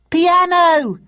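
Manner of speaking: high, loud interjection; heckler's shout. A narrator's reading. Audience member suggesting an instrument, shouting. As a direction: shout it out from the stalls. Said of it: "shouted out one person near us".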